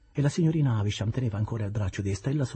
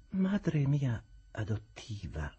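calm Estella; 7 semitones higher, much slower than usual. Acted. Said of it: "senza mai alzare la voce".

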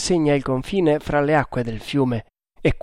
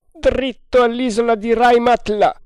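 high, loud helm command; yelled order; 10 semitones higher, 5 dB louder than usual. A narrator's reading. Haider to the helmsman, yelling. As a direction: yell it across the deck. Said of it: "urlò Haider al timoniere".

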